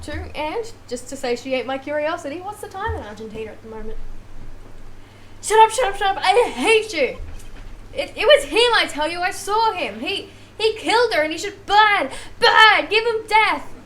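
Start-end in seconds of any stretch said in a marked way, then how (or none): 5.4-13.7 high, loud shouting of a cornered witness; acted furious accusation; hysterical angry rant. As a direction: lash out in a frenzy, voice raised.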